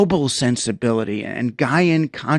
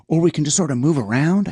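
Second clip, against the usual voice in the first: ordinary delivery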